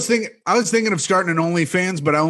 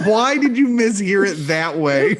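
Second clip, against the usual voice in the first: usual speaking voice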